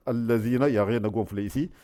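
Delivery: ordinary delivery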